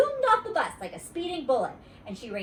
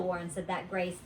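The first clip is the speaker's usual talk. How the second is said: ordinary delivery, nothing marked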